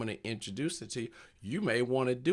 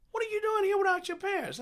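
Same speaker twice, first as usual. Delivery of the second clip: squeaky, much higher incredulous scolding; high mock outrage; faster than usual. Comedian scolding an unsupervised kid, in a high mock-shocked voice. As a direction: crank the pitch way up in fake shock.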